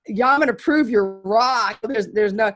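high, loud, cocky challenge; defiant retort. Retold or imitated. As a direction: dare them to be wrong. Defiant retort to vets, retold.

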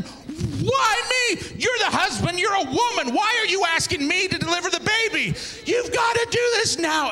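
high, rising comic rant; worked-up, high-pitched objection; frantic protest. Retold or imitated. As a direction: plead in escalating panic. Frantic pleading with husband and assistant.

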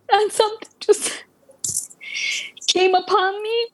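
high, choked-up testimony with halting pauses; moved, words coming haltingly; overcome with emotion. Real, not acted. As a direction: recall it overwhelmed, voice pitched high. Overcome recalling her conversion moment.

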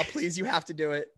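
usual speaking voice

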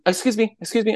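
high-pitched mock interruption; insistent woman. CEO interrupting a fake phone call, mock insistent. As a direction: imitate a woman butting in.

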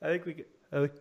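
holding back a sneeze; about to sneeze. Real, not acted. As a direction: talk through an oncoming sneeze. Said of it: "I'm about to sneeze".

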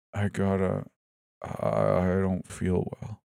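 fading and slurring; groggy slurring. A narrator's reading. Drugged, fading.

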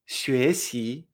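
"xuexi" with two questioning rises; tones two-two. Shown as a demonstration. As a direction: lift each syllable of "xuexi" as if surprised twice. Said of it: "me extraño dos veces".

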